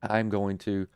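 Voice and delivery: usual speaking voice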